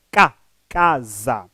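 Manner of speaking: "K, casa" slowly, low, first syllable drawn out; stress on first syllable. Shown as a demonstration. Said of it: "pronunciada com maior intensidade da voz". ("K, casa" devagar, com acento tônico na primeira sílaba)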